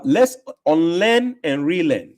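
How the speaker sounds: slow, drawn-out, deliberate motto; slow emphatic slogan. Real, not acted. Host answering a viewer with his slow, deliberate motto. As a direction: lay the motto down slowly, word by word.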